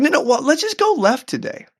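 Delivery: imitating wife, high-pitched; light insistence. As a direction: push the idea in a high voice.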